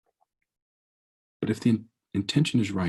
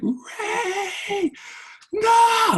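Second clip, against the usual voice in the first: wailing high and loud through tears, hammed up; mock bawling wail; 4 dB louder than usual. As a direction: cry out to the dying firefly in exaggerated grief. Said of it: "I'm just bawling".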